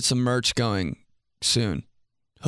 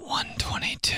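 whispered slate; 5 dB quieter than usual. Shown as a demonstration. Slating the shot for the recording, whispered. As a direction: whisper the take number. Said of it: "let's start this over".

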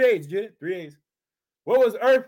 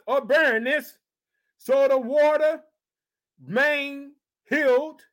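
raised, ringing preacher's reading of scripture; 5 semitones higher, slower than usual. Acted. Preacher reads scripture to his congregation, raised and ringing. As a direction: proclaim the verse to the church.

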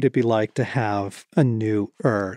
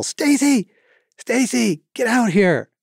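retold yell; 10 semitones higher than usual. Husband calling his wife out to see Yosemite Falls, high retold yell. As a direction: re-enact an excited yell to someone indoors. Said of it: "All I could do was yell".

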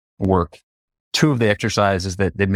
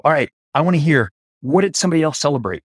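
group leader call; 6 semitones higher, faster than usual. Retold or imitated. Facilitator asking the circle of CEOs to share, lively. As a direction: get the circle sharing, upbeat. Addressing big circle of hikers.

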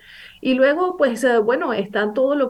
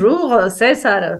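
French accent; 4 dB louder, slower than usual. Shown as a demonstration. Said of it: "¿Cómo saludan?".

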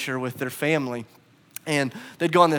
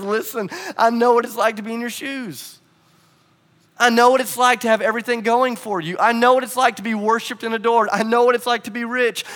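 tender Jesus; 5 semitones higher, 3 dB louder than usual. Acted. Jesus voiced tenderly to young man.

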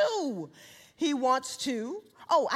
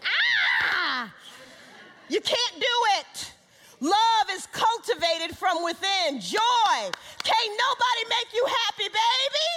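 raised, ringing sermon voice; preaching at full force; 10 semitones higher, 4 dB louder, slower than usual. Acted. Preacher proclaiming fruits of the Spirit, raised and ringing. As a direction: drive each fruit home with fervor.

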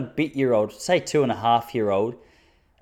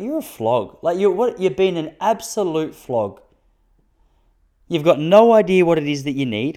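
mock rant; 5 semitones higher, 4 dB louder than usual. Ranting at crying baby.

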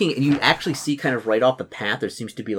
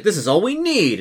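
exclaiming paladin; 8 semitones higher, 3 dB louder than usual. Acted. Paladin exclaims about the small garrison.

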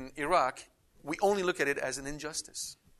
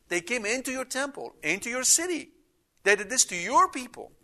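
higher, earnest plea to God; psalmist pleading; 9 semitones higher, 3 dB louder than usual. Retold or imitated. Psalmist tells God what was done to his people, pleading. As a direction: plead on God's behalf. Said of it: "His focus is on God".

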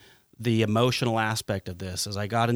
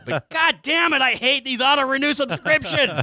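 high, loud exasperated laugh-rant; half laughing, half raging at it; laughing exasperation; 14 semitones higher, 4 dB louder than usual. Real, not acted. Host ranting to cohost about auto-renew, laughing fury. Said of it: "I'm still pissed just talking about it".

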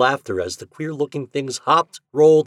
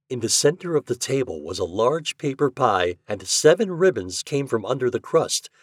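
usual speaking voice

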